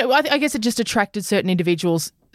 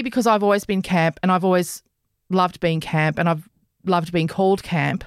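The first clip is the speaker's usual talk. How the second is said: ordinary talk, nothing marked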